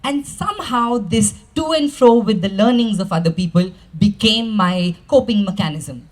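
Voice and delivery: ordinary talk, nothing marked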